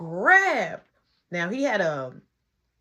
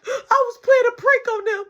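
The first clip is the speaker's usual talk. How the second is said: high, loud tearful wail; hammed-up sobbing; mock crying; 9 dB louder than usual. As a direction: bawl out an excuse like a child. Imitates boy crying to nana.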